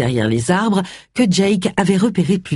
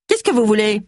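higher-pitched, curt demand; barking; 6 semitones higher than usual. A narrator's reading. Sour housekeeper opens the door to strangers, barking. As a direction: snap it like a grumpy doorkeeper. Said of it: "leur ouvrit en aboyant".

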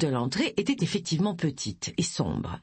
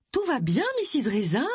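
concerned calling; 6 semitones higher than usual. A narrator's reading. Concerned woman calls through toilet door.

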